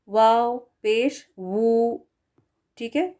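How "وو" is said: slow rounded wu, lips pushed forward; rounded wu sound. Shown as a demonstration. Teacher isolates the وو sound slowly. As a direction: hold وو long with round lips. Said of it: "ہونٹوں کو گول رکھیں".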